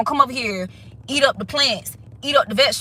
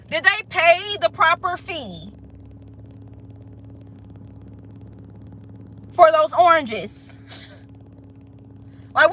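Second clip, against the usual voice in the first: indignant confrontation; 7 semitones higher than usual. Acted. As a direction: demand answers from the one who opened the gate. Owner confronting betrayer.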